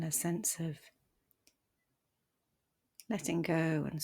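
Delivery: soft, unhurried meditation voice with long pauses; guided meditation. Acted. Meditation teacher guiding listeners to settle the body, softly and calmly. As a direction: ease them gently into stillness, leaving space between phrases.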